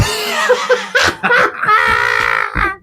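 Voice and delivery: high shrieking laugh. Real, not acted. Bursting out laughing.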